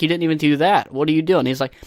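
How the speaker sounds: normal talking voice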